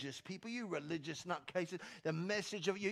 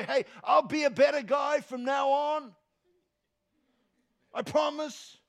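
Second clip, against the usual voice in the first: desperate promise; 9 semitones higher, 11 dB louder than usual. Acted. Thief pleading on the cross.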